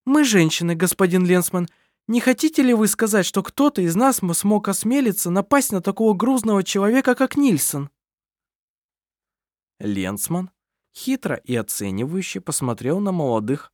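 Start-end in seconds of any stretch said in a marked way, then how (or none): 0.0-7.9 indignant. Acted. Kerstin indignant rebuttal.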